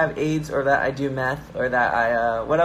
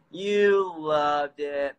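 very slow, stretched "You loved it"; long, lingering delivery; drawn-out insistence; much slower than usual. Real, not acted. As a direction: stretch each word out, insisting. Teasing repeat, drawn out.

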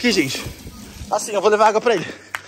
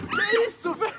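laughing disgust; 11 semitones higher, 5 dB quieter than usual. Real, not acted.

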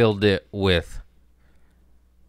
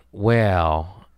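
ordinary delivery

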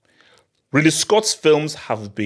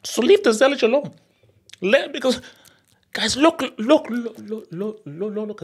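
very high-pitched, comic protest; mock pleading defence; 11 semitones higher than usual. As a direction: shoot the voice way up, pleading for the actor. Mock defence of favourite actor.

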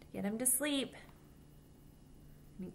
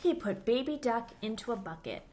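put-on lively storybook voice for little kids; reading to children; 3 semitones higher than usual. A narrator's reading. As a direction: read it aloud like storytime for children. Reads picture book to children.

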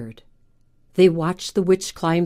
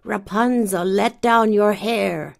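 calling witch; 3 semitones higher than usual. Acted. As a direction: call up the tower like a witch. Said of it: "she called out".

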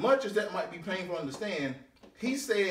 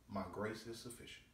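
hushed reply; 9 semitones lower, 16 dB quieter than usual. Acted. God's reply quoted, hushed.

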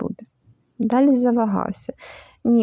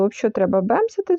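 ordinary delivery

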